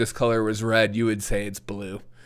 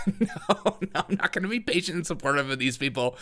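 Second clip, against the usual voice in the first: laughing, softer refusal; 5 semitones higher, 4 dB quieter than usual. Real, not acted. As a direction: laugh off the advice.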